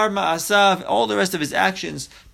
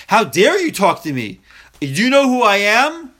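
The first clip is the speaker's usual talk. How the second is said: louder and higher, haughty mock outrage; indignant outburst; 5 semitones higher, 6 dB louder than usual. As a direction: bark it like an offended big shot. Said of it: "That's his attitude".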